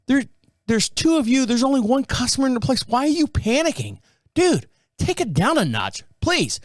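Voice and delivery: silently begging him to calm down; exasperated inner voice. Retold or imitated. Thinking at the flustered clerk, mildly exasperated.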